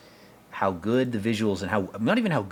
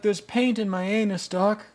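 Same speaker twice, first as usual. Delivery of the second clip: much higher, exaggerated mocking voice; parodying a movie character, pitched way up; 12 semitones higher than usual. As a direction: squeak out a whiny comic complaint. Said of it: "He's like".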